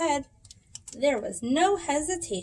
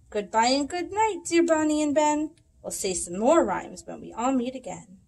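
ordinary delivery, nothing marked

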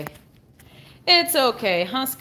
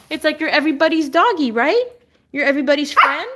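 higher, louder cooing questions; doting pet talk; 5 semitones higher, 5 dB louder, faster than usual. Real, not acted. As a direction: coo brightly at a beloved dog. Cooing to the dog.

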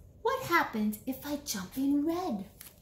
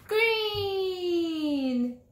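excited reveal; 7 semitones higher, 4 dB louder than usual. Pretend. Reveals the new colour.

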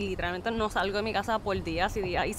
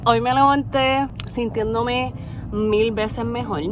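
ordinary talk, nothing marked

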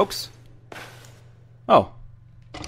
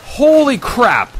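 slow, drawn-out, high gasp of disbelief; stunned holy crap; 7 semitones higher, 4 dB louder, much slower than usual. Real, not acted. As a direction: let it sink in slowly. Stunned exclamation on first sight.

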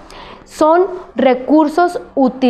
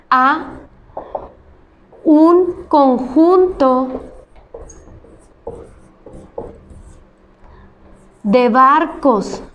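slow dictation; slower than usual. Shown as a demonstration. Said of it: "Lo voy a apuntar en el pintarrón".

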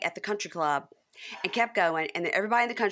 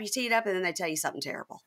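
usual speaking voice

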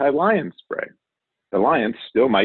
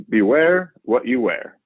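slow dramatic title; 2 semitones higher, slower than usual. Acted.

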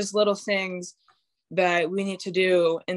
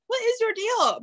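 high-pitched incredulous retort; mock exasperation. Player to a feisty little guard, mock exasperation. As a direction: act fed up, pitch shooting up.

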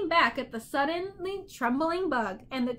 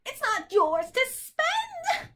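very high, drawn-out screech; shrieking bird; 12 semitones higher, slower than usual. A narrator's reading. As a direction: shriek it slowly like a cranky bird. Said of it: "the bird shrieked again".

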